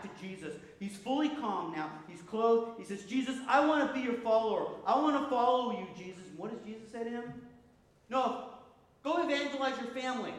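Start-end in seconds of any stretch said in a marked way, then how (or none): 3.0-6.2 loud, high-pitched eager plea. Acted. Freed man begs Jesus to let him follow, eager and loud. 8.1-10.3 raised, higher-pitched firm order; refuse, then send him off emphatically; firm command. Acted. Jesus answers the man who would follow, firm and loud.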